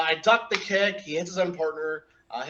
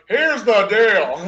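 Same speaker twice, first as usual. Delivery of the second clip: slow, loud, cocky announcement; mock tough talk; 4 semitones higher, 9 dB louder, much slower than usual. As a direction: lay it down slow like a tough guy. Acting tough before the match.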